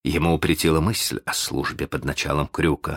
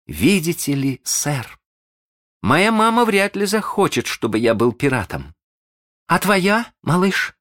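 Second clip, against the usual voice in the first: lightly voiced child, higher pitched; prudent boy; 12 semitones higher than usual. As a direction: reason politely with the pirate captain in a boy's voice. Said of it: "Шалун решил быть благоразумным".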